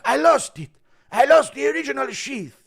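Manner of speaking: high, loud mock wail; YouTube short imitation. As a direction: cry out like the viral clip. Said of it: "some random YouTube short".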